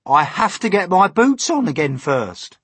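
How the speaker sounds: loud, high centipede insistence; put-on high, loud voice; indignant centipede. A narrator's reading.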